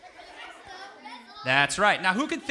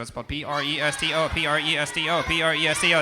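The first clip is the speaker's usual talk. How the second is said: P R E S T O spelled out, louder each time; building spelling; 5 dB louder than usual. Shown as a demonstration. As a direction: spell presto, climbing in volume. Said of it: "spelling presto, building".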